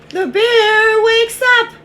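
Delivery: loud, high storybook announcement; dramatic alarm. A narrator's reading. Telling children the bear wakes up, dramatic alarm. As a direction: sound the alarm to the listeners.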